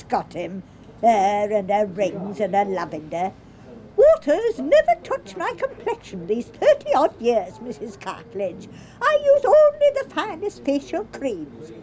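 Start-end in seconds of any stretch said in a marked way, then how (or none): 4.0-11.7 higher, put-on genteel voice, mincing each word; mocking a posh lady. To Irene, mimicking Mrs Browning's boasts in a higher, put-on voice. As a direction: send up the rival's airs and graces.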